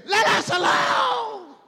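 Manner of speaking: very high, loud, drawn-out scream; full-force demon shriek, words stretched long. Acted. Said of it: "He started screaming".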